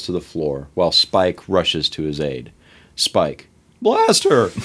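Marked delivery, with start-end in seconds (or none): 3.8-4.7 put-on squeaky teen voice. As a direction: call out like a panicked teenage boy. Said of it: "I did squeaky voice teen last time".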